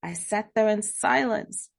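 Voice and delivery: plain reading voice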